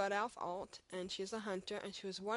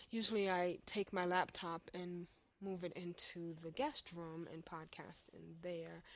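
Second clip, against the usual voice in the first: ordinary talk, nothing marked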